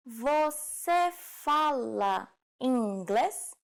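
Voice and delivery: very slow, word by word; slow demonstration. Shown as a demonstration. Teacher models the informal question for learners, very slowly. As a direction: stretch out each word for learners.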